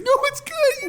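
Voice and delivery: very high mimicked reassurance; mock-sincere coworker; overly earnest. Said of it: "he's so earnest".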